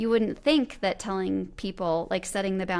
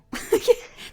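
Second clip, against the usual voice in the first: high giggle; 5 dB louder than usual. Real, not acted. Laughing after whisper.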